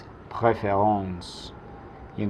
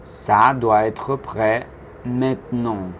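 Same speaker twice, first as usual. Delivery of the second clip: slow, measured French with a pause before "maintenant"; slow model; slower than usual. Shown as a demonstration. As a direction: model it slowly for learners to repeat. Teacher's slow model sentence.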